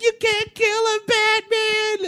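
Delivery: high sung-out plea; hammed-up high damsel cry; mock Lois Lane. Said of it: "she's like".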